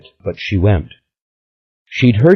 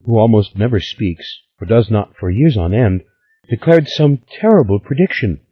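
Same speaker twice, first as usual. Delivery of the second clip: put-on high, lively girlish voice; a lightly voiced excited young Stella; eager young woman; 5 semitones higher than usual. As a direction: spill the gossip breathlessly.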